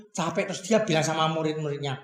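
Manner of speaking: ordinary delivery, nothing marked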